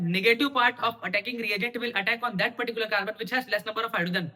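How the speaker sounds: fast, run-together recital of a rule; rapid-fire. Real, not acted. Teacher reciting Markovnikov's rule to students, rapid-fire. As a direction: rattle it off at top speed. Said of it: "क्या speed से बोल रहा हूँ".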